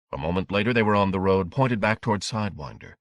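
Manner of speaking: ordinary delivery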